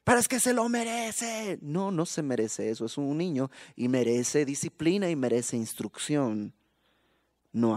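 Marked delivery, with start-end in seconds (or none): 0.0-1.6 defensive parent. Acted. Abusive parent justifies himself.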